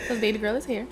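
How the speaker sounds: normal talking voice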